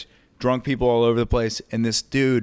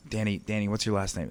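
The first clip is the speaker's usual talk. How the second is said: quiet aside; 2 semitones lower, 7 dB quieter, faster than usual. Retold or imitated. Host privately asking a heckler his name, quiet aside. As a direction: ask it discreetly, under the show.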